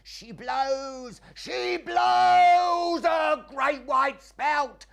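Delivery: high, slow, drawn-out bellow; lookout's shout. Retold or imitated. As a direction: call from the masthead at full voice. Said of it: "I had to keep shouting".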